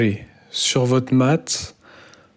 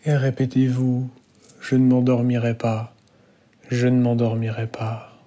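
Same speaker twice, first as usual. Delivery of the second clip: guided meditation. Real, not acted. Yoga teacher guides students lying down into Yoga Nidra, calm guided meditation.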